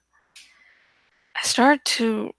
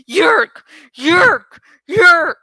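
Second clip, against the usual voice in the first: piercing screeched cries, much louder, rising each time; screaming; 3 semitones higher, 8 dB louder, much slower than usual. A narrator's reading. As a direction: shriek it like a mad old woman attacking. Woman screams while slamming hatch.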